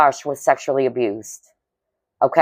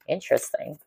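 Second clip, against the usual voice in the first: Interesting said softly to herself, under the breath; quiet musing; 11 dB quieter than usual. Real, not acted. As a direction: murmur Interesting to yourself, much softer. Musing to herself over a song.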